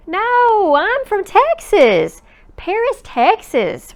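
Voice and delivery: put-on a boy explaining patiently; boy correcting. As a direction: set her straight, kid's voice. Robert corrects her.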